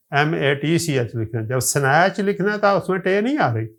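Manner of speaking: ordinary delivery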